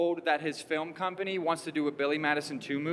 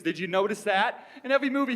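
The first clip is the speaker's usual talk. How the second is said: usual speaking voice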